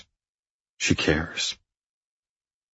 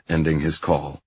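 ordinary delivery, nothing marked

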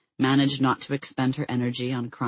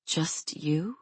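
croaking; 2 semitones higher, 6 dB quieter than usual. A narrator's reading. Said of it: "she croaked".

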